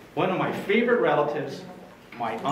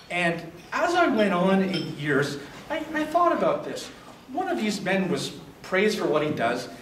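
ordinary talk

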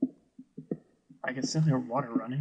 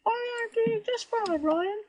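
very high falsetto voice with a bad British accent; poor British accent; 6 dB louder than usual. [name] answers Ryan back, very high poor British accent. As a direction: squeak it out in a hammed-up British accent. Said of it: "talking in a British accent? A poor British accent".

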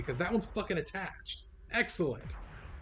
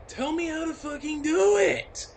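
high, loud demand; real frustrated shout; angry shout; 11 semitones higher, 6 dB louder than usual. Real, not acted. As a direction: yell it at the screen. Yelling at the game.

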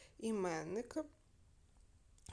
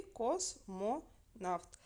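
slow, split космонавт into кос, мо, навт; syllable by syllable; much slower than usual. Shown as a demonstration. Teacher showing pupils the three syllables of космонавт, syllable by syllable. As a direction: say космонавт slowly with a gap after each syllable. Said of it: "У нас є три склади".

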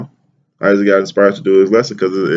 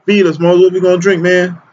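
higher-pitched whining crowd imitation; mock complaining; 9 semitones higher than usual. Thirsty Israelites demanding drink from Moses, mock complaining. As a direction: complain at a much higher pitch, mimicking them. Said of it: "complaining and shit".

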